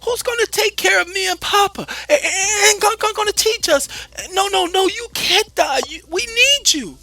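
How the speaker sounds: high, loud, sobbing plea; begging his mother not to die; desperate child. Acted. Child begs dying mother.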